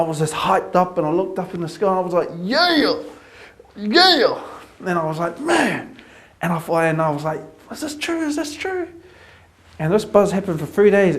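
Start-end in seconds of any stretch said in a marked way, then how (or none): 2.4-4.4 high, loud joyful yeah; elated cry. Retold or imitated. Looking up at sky, elated. 5.4-6.0 high-pitched amazed man; a soaring wonder-struck man; awed exclamation. Retold or imitated. Overwhelmed by the morning. 7.7-8.9 high, soft disbelieving question; whisper-like amazement at a miracle; high and hushed, asking in awe; awed wonder. Retold or imitated. Marvelling at the sky.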